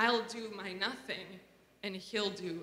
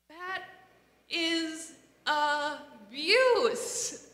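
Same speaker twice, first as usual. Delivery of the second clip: a much higher, louder and very slow "That is abuse"; outraged; 7 semitones higher, 8 dB louder, much slower than usual. Real, not acted. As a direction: drag each word out in real outrage. Outrage after retelling the story.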